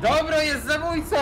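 shrill triumphant squeal; high villain shout. Acted. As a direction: gloat it up high. Villain announces in game.

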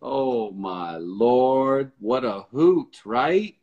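slow, stretched-out exclamation; drawn-out amazement. Real, not acted. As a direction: drag out the vowels in amused disbelief. Host reacts after a hilarious caller.

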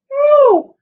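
sudden high, loud squeak of no; a cartoonish high no to tease the kids; playful squeaky no. Teacher teasing the class with a high loud no.

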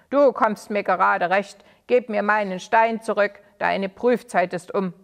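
loud, harsh order; old villain ordering Sali to hand over the stone; commanding demand. Acted.

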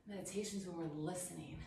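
hushed meditation. Acted. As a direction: murmur it like a quiet insight. Meditative teaching aside.

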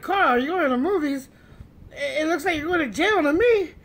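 baffled objection; incredulous challenge. Retold or imitated. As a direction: challenge a friend's absurd claim, loudly. Challenging friend's absurd claim.

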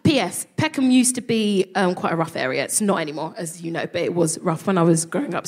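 normal talking voice

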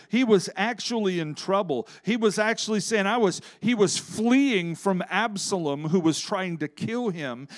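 usual speaking voice

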